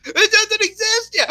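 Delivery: very high, yelped retort; high-pitched mock shouting; imitated protest. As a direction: squeal back an objection in a mock argument. Acted reply in retold argument.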